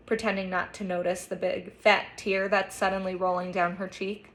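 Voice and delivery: ordinary delivery, nothing marked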